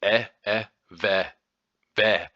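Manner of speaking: "eh, eh, ve, ve" with the short e of get. Shown as a demonstration. Explaining the letter E to listeners, demoing the sound. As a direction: demo "eh" like get, then "ve". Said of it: "pronounced like the E in get".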